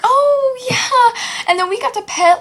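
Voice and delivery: high, loud mock enthusiasm; mock interrupting friend. Friend Brianne carrying on over her, mock gushing. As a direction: barrel on excitedly, imitating an oblivious chatterer.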